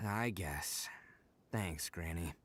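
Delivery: sigh, then whisper it, giving in; quiet reluctant surrender; resigned whisper. Acted. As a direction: breathe it out defeated.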